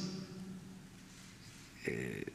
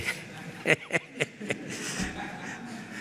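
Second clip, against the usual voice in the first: soft breathy laugh; 4 semitones higher, 7 dB quieter than usual. Real, not acted. Amused aside about the cold.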